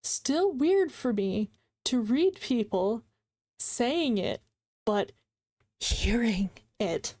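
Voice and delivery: very slow, broken into separate phrases; deliberate pauses between chunks, puzzling it out; slow careful rereading. Real, not acted. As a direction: read it out piece by piece to work out the meaning. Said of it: "Let me read it again".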